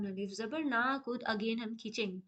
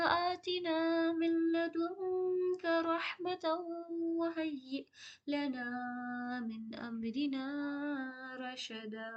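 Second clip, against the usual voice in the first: melodic recitation; 5 semitones higher, slower than usual. Acted. Teacher recites the verse as a prayer, slow chant. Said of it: "हिफ्ज करने की कोशिश करते हैं".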